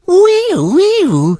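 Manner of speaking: playful high-pitched squeals; silly noises. Shown as a demonstration. Testing a voice changer with silly high noises. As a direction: trill silly nonsense, swooping way up high. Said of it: "What if I make silly noises".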